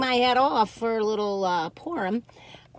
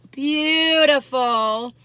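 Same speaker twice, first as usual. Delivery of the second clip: drawn-out awe; 4 semitones higher, 5 dB louder, much slower than usual. Real, not acted. Admiring the soda bread.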